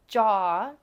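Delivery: "jaw" said loud, tongue spread on the roof of the mouth; tongue pressed to palate. Shown as a demonstration. Teacher shows learners a difficult sound, "jaw" with tongue pressed up. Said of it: "your tongue is, um, pressed against the top".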